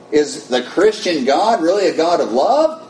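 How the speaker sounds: incredulous inner voice. Retold or imitated. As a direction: toss the question back in frantic disbelief. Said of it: "I'm thinking".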